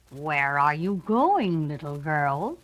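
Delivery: low, slow wolf voice; a big bad wolf, low and drawn out. A narrator's reading. Wolf asking the little girl, low and slow. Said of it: "asked the wolf".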